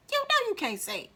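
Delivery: high, emphatic aside; high-pitched insistence. Shown as a demonstration. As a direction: say it way up high, teasing the point home. Repeats the line in high voice.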